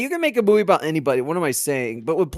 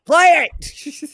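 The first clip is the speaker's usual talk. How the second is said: impatient shout; 11 semitones higher, 4 dB louder than usual. Real, not acted. Impatient call to start movie.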